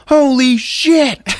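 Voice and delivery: high, loud, slow hammed-up gasp; mock shocked yell. Dick finding Jane hiding, mock shocked yell. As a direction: drag it out in shock.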